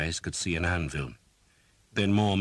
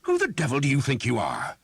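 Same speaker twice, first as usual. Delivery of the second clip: much higher, louder tirade; lost temper; 10 semitones higher, 7 dB louder than usual. Acted. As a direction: let your temper snap. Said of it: "Father Hobb had a temper, and he lost it now".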